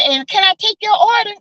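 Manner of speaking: high, bright server's question; waitress voice. Retold or imitated. Mimicking the waitress ignoring her, high chirpy tone. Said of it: "she said".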